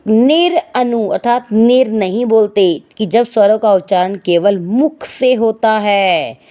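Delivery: ordinary talk, nothing marked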